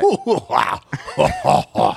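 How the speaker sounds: slow, drawn-out creature belly laugh; space-slug chortle in separate bursts; Jabba the Hutt laugh. Acted. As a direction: laugh like a big alien crime lord. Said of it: "sounds like Tom Hanks as Jabba the Hutt".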